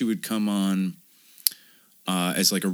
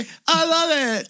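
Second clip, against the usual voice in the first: high, loud sarcastic cheer; hammed-up squeal of delight; mock celebration; 4 dB louder than usual. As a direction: cheer with exaggerated fake glee. Mocking her lack of upset.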